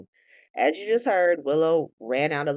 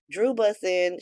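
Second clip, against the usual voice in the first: usual speaking voice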